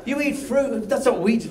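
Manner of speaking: Irish-ish own voice. Retold or imitated. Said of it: "sorry about the accent".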